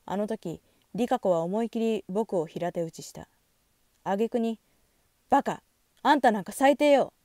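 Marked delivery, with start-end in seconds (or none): 5.3-7.1 higher, louder voiced scolding; angry insult. A narrator's reading. Rikako cursing the narrator after slapping him, angry. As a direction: hurl it as an outraged rebuke. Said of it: "と罵り".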